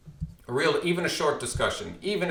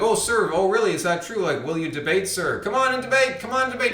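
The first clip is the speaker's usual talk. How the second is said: much higher, teasing call after him; mock pleading; 8 semitones higher, 5 dB louder than usual. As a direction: taunt him to come back and debate. Mocking reply to departing man.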